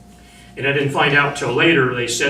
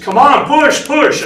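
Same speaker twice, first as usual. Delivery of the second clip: much higher, loud desperate yell; 13 semitones higher, 9 dB louder, slower than usual. Retold or imitated. Marine screaming at wounded shipmate to push, desperate yell. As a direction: scream push at him with everything. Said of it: "I'm yelling at him".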